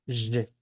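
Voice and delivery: Zh as a buzzing hushing sound; voiced zh sound. Shown as a demonstration. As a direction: buzz Zh like French "j". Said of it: "así".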